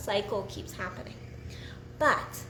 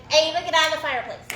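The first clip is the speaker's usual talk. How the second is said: quick, raised scolding call; real sharp command, rushed and louder; 2 semitones higher, 5 dB louder, faster than usual. Real, not acted. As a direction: call out a fast, loud order to someone across the room. Ordering Ava out of the fireplace.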